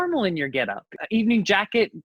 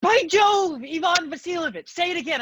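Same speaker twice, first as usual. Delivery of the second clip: startled; 6 semitones higher than usual. Acted. The father startled by the proposal.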